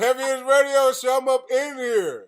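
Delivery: loud, high, drawn-out hype-man DJ shout; mock radio DJ. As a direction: belt out the show intro like an over-the-top DJ. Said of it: "He could be like".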